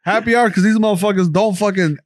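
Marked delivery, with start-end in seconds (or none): 0.0-0.3 high, playful shout-out to a guest; hyped invitation to a missing guest, higher; mock invitation call-out. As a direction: call him out like a hype man. Calling out to absent guest.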